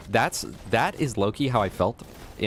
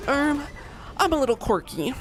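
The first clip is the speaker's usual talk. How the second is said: put-on much higher, shy girly voice; high quirky girl.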